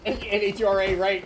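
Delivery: normal talking voice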